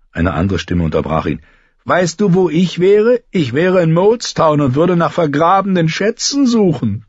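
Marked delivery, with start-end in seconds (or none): 1.8-7.0 very high, teasing voice; mischievous high-voiced boy; cheeky young joker. A narrator's reading. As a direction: butt in with a cocky joke, pitched high. Toad interrupts with a joke.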